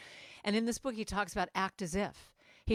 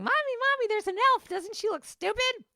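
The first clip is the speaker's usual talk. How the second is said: put-on much higher, louder child's voice; little kid; 5 dB louder than usual. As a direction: squeal like a small kid pointing. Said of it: "I now have little kids going".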